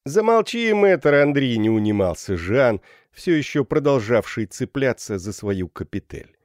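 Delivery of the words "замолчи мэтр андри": high, raised-voice warning; shouted threat. A narrator's reading. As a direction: call down a cheeky threat. Student threatens from the pillar. (задиристый окрик)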